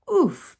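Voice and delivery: high, loud grunt of surprise; knocked out of him by the whale; king hit by the baby whale grunts; surprised grunt. A narrator's reading. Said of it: "the king gasped in surprise".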